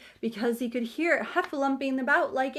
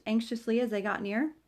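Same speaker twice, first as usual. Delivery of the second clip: ordinary delivery, nothing marked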